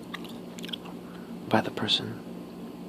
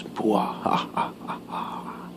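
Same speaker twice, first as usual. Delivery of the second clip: evil laugh; slower than usual. Pretend. Mock villain laugh after millions.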